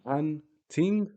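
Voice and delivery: "Hunting" high, split into hun, ting; stop on the N. Shown as a demonstration. Teacher showing sukoon in English, split syllables. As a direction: pause after the N in "Hunting". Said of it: "It's a stop in pronunciation".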